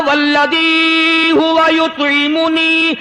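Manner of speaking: slow, drawn-out chanted verse; quranic recitation. Real, not acted. Preacher reciting scripture to the congregation, slow chant. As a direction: recite the verse slowly and reverently.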